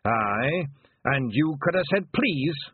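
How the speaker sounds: high, loud Scottish brogue; proud Scottish elder. Acted. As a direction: refuse her as a proud old Scot.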